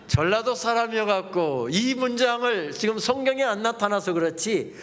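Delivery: ordinary talk, nothing marked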